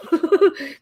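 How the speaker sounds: high burst of giggles; real amused laugh. Real, not acted. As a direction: giggle high at a cheeky joke. Laughing at Shannon's rude comment.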